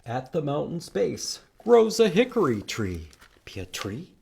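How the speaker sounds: ordinary talk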